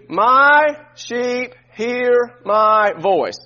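very slow, MY leaned on; "My sheep hear my voice" spoken very slowly with weight on "my"; slow call to repeat. Shown as a demonstration. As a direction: say it slowly so they can echo it. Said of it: "Would you say this after me?".

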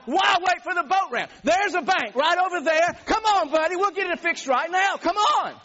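heated holler at a rival; yelled challenge. Retold or imitated. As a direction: dare him to settle it on the spot. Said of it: "I'm at the top of my vocal ability".

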